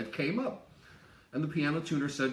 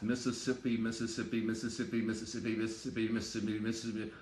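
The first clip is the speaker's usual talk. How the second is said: "Mississippi" rattled off faster and faster, softer, blurring into a mumble; really fast; 3 semitones lower, 4 dB quieter, faster than usual. Shown as a demonstration. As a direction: speed through the word until it slurs. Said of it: "really fast".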